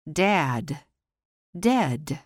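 ordinary talk

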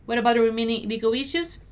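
brisk natural-speed read; fast, connected teacher's model. Shown as a demonstration. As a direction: run it together quickly. Teacher repeats at quicker pace.

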